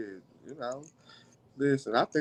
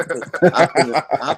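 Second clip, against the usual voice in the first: laughing while hyped up; bursting into laughter mid-brag; 8 dB louder than usual. Real, not acted. Excited boast, laughing.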